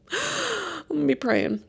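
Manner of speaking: high, slow, exaggerated despair; mock despair. Real, not acted. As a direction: stretch it out like a weary prayer. Mock prayer about preteen.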